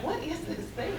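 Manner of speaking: retold puzzled question. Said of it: "and I said to Dad".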